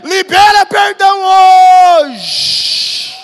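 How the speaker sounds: impassioned sermon climax; full-force preaching. Real, not acted. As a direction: drive the congregation to act, rising steadily.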